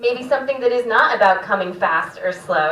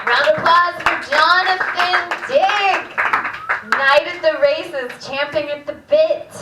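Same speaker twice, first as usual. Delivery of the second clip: raised, ringing stage-host announcement; MC applause call; 5 semitones higher than usual. Real, not acted. Host thanking the act just finished, high announcer call.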